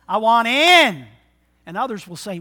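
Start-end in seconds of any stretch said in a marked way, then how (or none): 0.1-1.1 loud, slow and eager; eager shout. Acted. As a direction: call it out like you mean it. Eager convert's response quoted.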